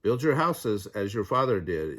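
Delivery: plain reading voice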